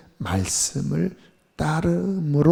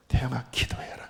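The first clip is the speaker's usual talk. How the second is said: soft, near-whispered command; hushed divine call; 4 semitones lower, 9 dB quieter than usual. Retold or imitated. God tells the pastor to pray, hushed. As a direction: imitate a quiet voice from God.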